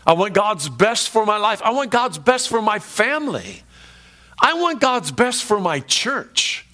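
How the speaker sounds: high, building resolve, slowing on the last; impassioned declaration. Acted. Preacher voices a believer's rising declaration.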